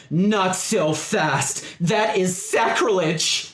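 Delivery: slow, high indignant call. Acted. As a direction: draw it out like an outraged zealot. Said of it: "another voice calls out as it gets close".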